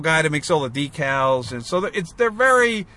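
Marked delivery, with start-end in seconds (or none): none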